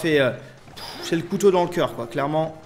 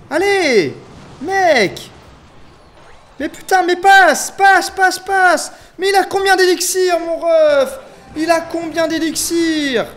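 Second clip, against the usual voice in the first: very high, loud exasperated shouts; real screaming frustration; desperate yelling; 11 semitones higher, 9 dB louder, slower than usual. Real, not acted. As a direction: yell helplessly at the game, at full force. Yelling as the attack gets through.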